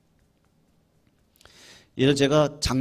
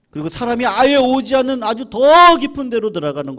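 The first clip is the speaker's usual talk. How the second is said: building emphasis; 10 semitones higher, 11 dB louder than usual. Acted. Repeated line building up.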